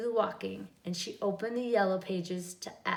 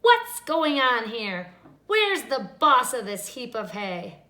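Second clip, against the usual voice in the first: angry witch; 7 dB louder than usual. A narrator's reading. Witch demands.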